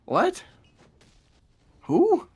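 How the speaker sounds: high, loud questioning yelps; hammed-up disbelief, pitch shooting up; mock bafflement. Reacting to the nonsense donkeys insult, mock bafflement.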